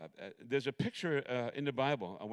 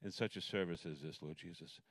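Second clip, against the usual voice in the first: soft, low opening prayer; hushed pastor's prayer; 6 semitones lower, 4 dB quieter than usual. Real, not acted. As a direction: pray quietly and reverently. Opening prayer before sermon.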